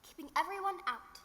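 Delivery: gentle bedtime story reading; storytelling. Acted. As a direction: read it like a fairy tale to a child.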